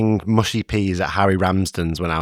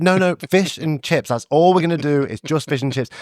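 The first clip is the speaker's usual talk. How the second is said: higher-pitched acted insistence; mock stubborn refusal; 7 semitones higher than usual. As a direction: play a cook refusing to budge. Imagined cook refusing any additions.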